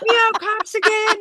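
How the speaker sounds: hammed-up shocked churchman; mock pastor. Pastor's reaction voiced.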